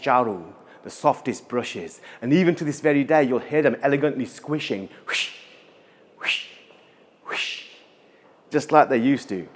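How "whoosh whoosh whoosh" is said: breathy fading swish sound; hushed airy whoosh; soft whispered whoosh. A narrator's reading. Imitating the broom swishing.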